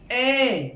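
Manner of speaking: ए said with extra force and breath; long vowel with force. Shown as a demonstration. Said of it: "जोर से बोलना पड़ रहा है". (ए को जोर लगाकर बोलें)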